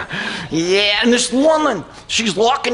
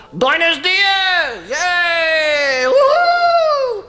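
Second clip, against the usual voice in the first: drawn-out greeting rising to a high whoop; excited cheer; 6 semitones higher, much slower than usual. Acted. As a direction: cheer it, stretching the vowels upward. Cheering memory of Mexico trip.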